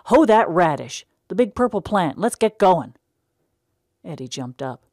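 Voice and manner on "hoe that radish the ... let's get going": loud, high barked commands; loud orders. A narrator's reading. Fed-up brother ordering Eddie to hoe weeds. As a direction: push him to get moving.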